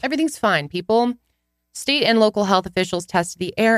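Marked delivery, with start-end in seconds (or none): 0.0-1.1 higher, mock-cheerful all-clear; mock reassurance. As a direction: parody an official saying all is fine. Mocking the officials' reassurance.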